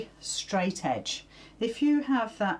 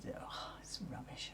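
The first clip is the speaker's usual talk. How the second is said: muttered under breath; whispered aside; 5 semitones lower, 19 dB quieter than usual. Real, not acted. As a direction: grumble it in a whisper. Muttering at a lagging comment feed.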